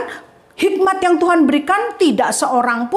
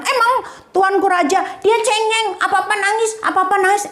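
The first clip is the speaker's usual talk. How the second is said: a much higher, scolding woman's voice; fierce woman; 6 semitones higher, faster than usual. Acted. The fierce mother mocks her rival to the king, high and scolding. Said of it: "yang satunya lagi galak".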